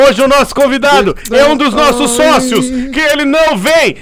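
high, loud building hype, rising to a yell; excited hype. Real, not acted. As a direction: pump up the crowd to a cry.

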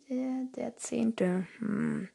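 slow sheepish whisper; whispered reply. Real, not acted. Asked which attempt by the director, whispering. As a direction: mumble the answer under your breath.

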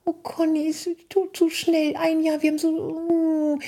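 high, slow lament of a couple; mimicking a sad bride. Conny imitates a couple sad it's over, high whining voice. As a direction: whine it like a wistful bride.

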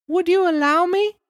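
put-on squeaky small boy answering the police; puppet boy. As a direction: Pinocchio answers the officers in a high boy voice. Pinocchio voice.

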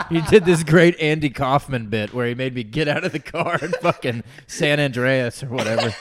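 joking retelling broken by laughter; laughing mock passenger. Co-host playing the passenger telling her next driver, laughing as he goes. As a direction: laugh your way through the imitation.

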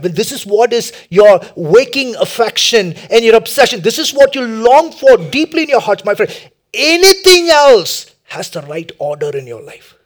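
loud, high, urgent preacher delivery; full-force preaching. Real, not acted. Preacher urging listeners to identify their idol, full-force preaching. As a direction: drive each point home at high volume.

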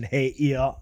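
ordinary talk, nothing marked

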